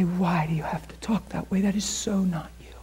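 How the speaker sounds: hushed, breathless whisper of conscience; soft unvoiced whisper, gently reproving; whispered voice of God. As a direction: whisper it like a still small voice. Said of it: "And his voice would say".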